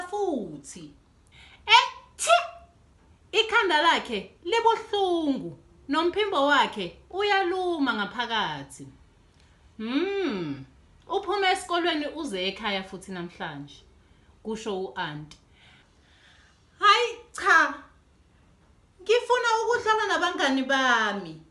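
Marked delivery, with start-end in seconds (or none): 1.7-2.3 high acted sneeze. Dudu sneezes again. 16.8-21.4 put-on higher, fast childish protest. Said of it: "kusho uDudu ekhwehlela".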